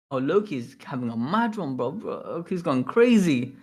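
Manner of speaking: ordinary talk, nothing marked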